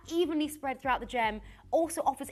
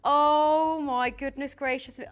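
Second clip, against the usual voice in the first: slow, loud gasp of delight; awed exclamation; 8 dB louder than usual. Real, not acted. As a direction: swoon over the ring, drawn out. Host gasps at ring reveal.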